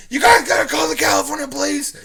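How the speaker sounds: high, loud retold begging; desperate pleading shout. Stranger on his knees begging teens, desperate shouting. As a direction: plead loudly as if on your knees. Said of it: "he kinda falls on his knees".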